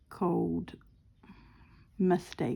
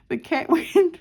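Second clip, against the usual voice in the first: high, loud, helpless real laughter; laughing hard; 9 semitones higher, 5 dB louder than usual. Real, not acted. Too tickled to finish the cat story, laughing. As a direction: lose it laughing mid-story. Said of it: "I'm laughing so much".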